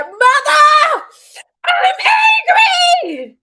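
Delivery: all-out high, loud scream; high-pitched furious yell, word by word; screaming in anger. Retold or imitated. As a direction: scream the rage out at full force. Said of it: "I just start screaming and crying and screaming".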